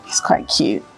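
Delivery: low confiding whisper. Real, not acted. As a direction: whisper it like a secret. Confiding aside about a guy.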